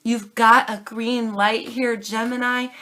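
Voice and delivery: usual speaking voice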